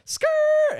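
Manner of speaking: high, loud screeching tyre noise; tyre screech imitation. Telling mates about the cop car, imitating screeching tyres. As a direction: skid it out like brakes locking up. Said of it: "starts screeching".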